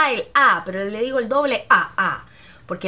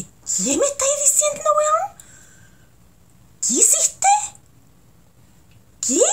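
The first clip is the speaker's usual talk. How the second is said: high, confronting "¿Qué me estáis diciendo, weón?"; threatening question. Shown as a demonstration. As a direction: challenge someone with a menacing question. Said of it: "casi amenazando la persona con una pregunta".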